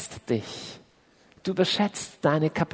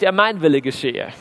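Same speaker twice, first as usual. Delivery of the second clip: a hint of a bossy tyrant; power-hungry self; 3 dB louder than usual. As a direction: play the inner control freak claiming his way. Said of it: "Der Machttyp in mir, der sagt".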